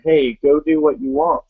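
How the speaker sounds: normal talking voice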